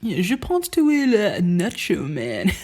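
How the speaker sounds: mock French Canadian bully, mixing languages; French Canadian accent. Bully switching from French to English, French Canadian accent. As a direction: imitate an uncool Quebec teenager's French Canadian accent. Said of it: "It's like that".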